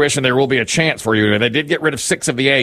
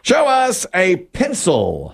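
high, stretched host's call; game-show reveal call; 6 semitones higher, much slower than usual. Real, not acted. Host checks captain's answer on the board, drawn-out reveal call. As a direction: draw it out toward the board.

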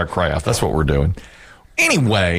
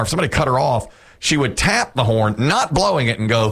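usual speaking voice

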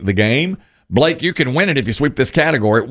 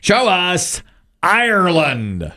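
high, slow, drawn-out reveal; host building suspense at the board; game show reveal call; 5 semitones higher, much slower than usual. Real, not acted. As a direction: sing out the call, stretching each word.

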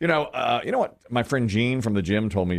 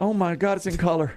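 mock awestruck, pitched up; high, wondering exclamation, played for laughs; amazed child; 7 semitones higher than usual. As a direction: lightly imitate a wide-eyed kid's wonder. Child amazed on entering stadium.

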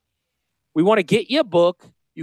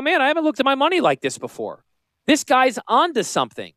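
impressed reader; 10 semitones higher than usual. Acted. Voices an impressed future reader of book.

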